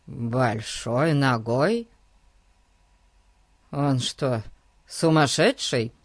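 taken aback, puzzled; amazed. Acted. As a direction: ask it in baffled disbelief. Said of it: "изумился мистер Троттевилл".

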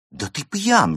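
high, loud, scandalised accusation; indignant. Acted. Friend catching the cat drunk, indignant. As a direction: burst out in outrage. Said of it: "возмутилась Берта".

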